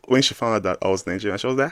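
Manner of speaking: normal talking voice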